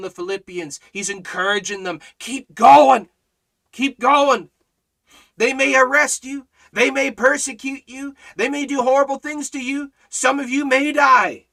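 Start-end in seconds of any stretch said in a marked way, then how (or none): 2.2-3.1 loud, high rallying cry; rally them like a coach; urgent exhortation. Acted. Preacher urging the Philippians on, loud. 3.7-4.5 high, louder echo of the cry; repeated exhortation. Acted. Preacher repeating his rallying cry. 5.4-11.5 high, loud preaching warning; raised warning. Acted. Preacher warning believers of persecution, high.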